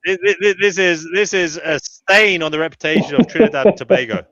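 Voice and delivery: high, loud, stammering pretend indignation; mock outrage. Host roasting a regular guest's audio, hammed-up outrage. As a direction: denounce him grandly, in jest. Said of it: "J E knows I'm only joking".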